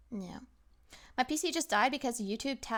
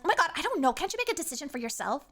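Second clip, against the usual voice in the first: irritated snap; 5 semitones higher, 4 dB louder, faster than usual. Retold or imitated. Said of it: "she instantly snaps at him".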